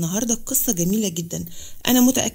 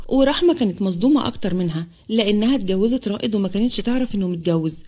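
ordinary delivery, nothing marked

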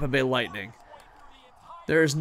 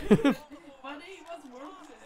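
ironic high voice; 13 semitones higher, 7 dB quieter than usual. Pretend. Ironic aside.

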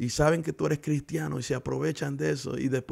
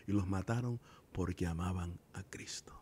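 hushed whisper; 5 semitones lower, 8 dB quieter than usual. Acted. Grave point about martyred Christians.

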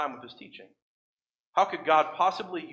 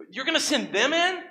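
incredulous question; 9 semitones higher than usual. Acted. Protesting God's plan.

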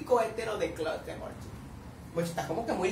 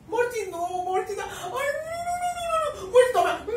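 high, crying "no, no, no"; panicked wail; 11 semitones higher than usual. Real, not acted. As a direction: wail in fan despair. Fan panics at the scene.

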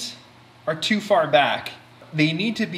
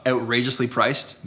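ordinary delivery

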